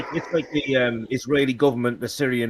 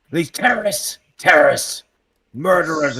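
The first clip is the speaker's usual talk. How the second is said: mock outrage, higher and punched; indignant news voice; 4 semitones higher, 5 dB louder than usual. Mimicking biased news reports on soldiers' deaths, mock outrage. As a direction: spit the labels out like a loaded bulletin.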